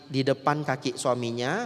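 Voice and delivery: ordinary talk, nothing marked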